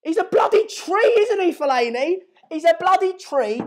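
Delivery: high, loud mocking outburst; excited rant. Real, not acted. Fan mocking a departing player, excited loud rant. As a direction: shout him down with glee. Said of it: "I got a bit excited there".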